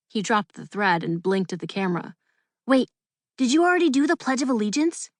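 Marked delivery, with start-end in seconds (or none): 2.7-5.1 flustered. A narrator's reading. Startled reporter blurts question.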